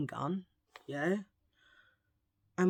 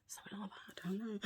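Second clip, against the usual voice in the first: whispered aside; 15 dB quieter, faster than usual. Real, not acted.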